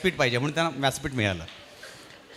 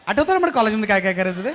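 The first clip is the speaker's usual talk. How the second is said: higher, louder, giggling through the line; teasing girlfriend voice; 5 semitones higher, 6 dB louder than usual. Retold or imitated. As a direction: tease him with a laugh. Friend teasing, laughing. (हसत, उंच आवाजात चिडवणारी मैत्रीण)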